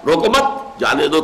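روکو مت as one group, then جانے دو; pause after مت. Shown as a demonstration. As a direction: join روکو with مت, break before جانے دو. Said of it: "روکو مت کو جمع کر دیا".

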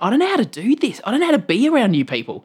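high, overwhelmed confession to the crowd; flustered gushing. Retold or imitated. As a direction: babble it out, caught off guard by kindness. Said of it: "I just went".

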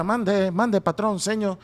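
put-on high-pitched humble Mexican accent. Comedian showing how some Mexicans talk, Mexican accent. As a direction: pipe up like a deferential Mexican servant. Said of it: "hay unos que hablan de esa manera".

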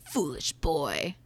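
slow, hushed demon taunt; lightly wheezing demon. Acted. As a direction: sneer it out low. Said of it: "with a voice".

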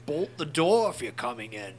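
a hint of a masked villain growl; Bane impression. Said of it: "[name], do your Bane".

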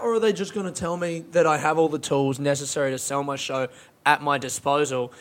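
usual speaking voice

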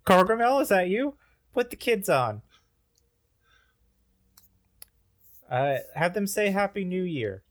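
high strange alien voice; alien Gargalax. Acted. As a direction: play the alien dad greeting. Alien dad on the phone.

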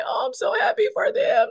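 sobbing with happiness for the twins; tearful joy. Retold or imitated. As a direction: let the joy break into tears. Crying with joy at baptism.

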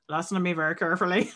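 put-on lightly done Irish Liam Neeson accent, a bit quick; a hint of Irish in a threatening Liam Neeson line. A fan imagining Liam Neeson threatening in an Irish accent. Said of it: "a Republic of Ireland accent".

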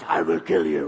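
mock-possessed child in a low growl; deep demonic voice. Upset daughter with glowing eyes, imitated deep menacing voice. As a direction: drop low into a comic demon voice. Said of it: "she said with a deep voice".

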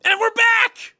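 announcer welcome back. Acted. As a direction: boom it like a radio host. Podcast segment opening.

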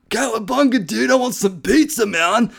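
high, loud California surfer-dude turtle; Michelangelo. Retold or imitated. Preacher imitating a surfer turtle, high and loud. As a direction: shout it like a pizza-crazed surfer turtle. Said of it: "Michelangelo from the Teenage Mutant Ninja Turtles".

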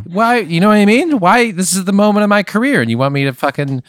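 high-pitched indignant outburst; outraged rant. Pretend. Comic refusing to ruin his big set, high indignant outburst. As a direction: protest it, voice jumping up high.

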